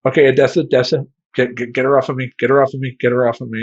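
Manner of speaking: loud, panicky pleading; get-it-off-me panic; panicked. Retold or imitated. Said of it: "I'm like".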